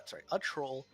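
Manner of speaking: ordinary delivery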